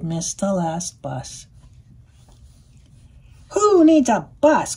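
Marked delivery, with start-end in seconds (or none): 3.5-4.8 cheery quack. A narrator's reading. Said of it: "quacked the duck".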